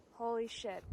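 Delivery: ordinary delivery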